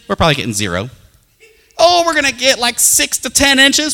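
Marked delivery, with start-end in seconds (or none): 1.8-3.9 mocking the weatherman, high and loud. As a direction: ham up the alarmed forecaster.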